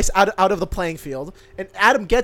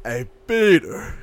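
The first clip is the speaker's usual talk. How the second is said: slow, lightly voiced Joe; Joe Swanson voice; slower than usual. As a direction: draw out a hint of Joe. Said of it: "do a really good Joe".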